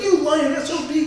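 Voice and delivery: angry accusation. Retold or imitated. Homeowner calls out the burglar's lie, retold angry yell. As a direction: throw the insult back in a mock yell.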